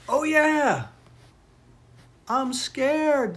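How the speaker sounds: high, loud acted fright; scared cry. A narrator's reading. Boys suddenly remember they are frightened, high loud scared cry. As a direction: cry out as two startled boys.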